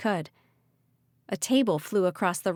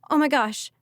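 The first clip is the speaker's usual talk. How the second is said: high, loud shocked gasp; 6 semitones higher, 4 dB louder than usual. Acted. Ridley gasping as Callie and Malachi are whipped away. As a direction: gasp as friends vanish. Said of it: "Ridley gasped".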